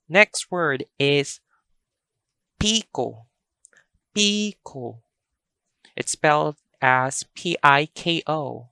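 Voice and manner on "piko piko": piko as a long, smooth PII-ko; piko without stops. Shown as a demonstration. Teacher models the next word, piko long and open. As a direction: stretch the first vowel of "piko", no catches. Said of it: "no stops at all".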